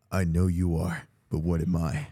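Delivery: low, smug schoolkid retort; schoolyard comeback. Acted. First grader answering a diss, smug and low. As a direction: drop the voice for a cocky comeback. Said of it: "All you had to say was".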